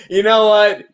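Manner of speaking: ordinary talk, nothing marked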